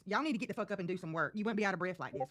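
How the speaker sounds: ordinary talk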